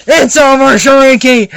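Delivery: loud, high-pitched yell at the villain; acted showdown yell; confrontational shout. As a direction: shout it in full voice.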